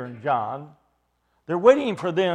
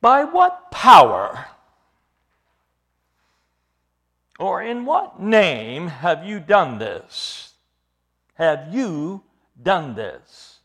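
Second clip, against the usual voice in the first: loud, slow, heavily weighted questioning; demanding inquiry; 8 dB louder, much slower than usual. Acted. Sanhedrin interrogating Peter and John, loud slow demand. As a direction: drag out each word like a booming interrogator. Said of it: "they started to inquire".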